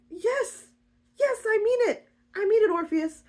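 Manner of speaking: high-pitched, emphatic reassurance; hammed-up heartfelt yes; earnest insisting. [name] insists she means it.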